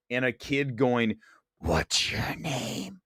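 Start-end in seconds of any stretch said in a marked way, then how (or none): 1.6-3.0 slow eerie whisper; creepy child voice. Retold or imitated. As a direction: ask slowly like a ghost child. A kid going.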